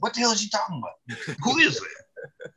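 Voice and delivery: high-pitched puzzled mock reaction; quick, high, imitating bewildered kids; baffled classmates. Classmates react to his unfamiliar name, baffled.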